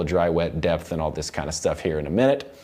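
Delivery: normal talking voice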